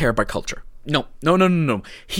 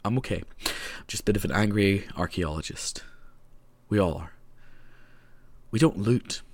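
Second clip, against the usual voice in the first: much softer and lower, reining it in; quiet, low comedown from an outburst; subdued after ranting; 9 semitones lower, 9 dB quieter than usual. Real, not acted. Calming down after an angry rant.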